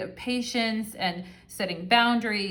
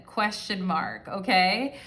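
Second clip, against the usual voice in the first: usual speaking voice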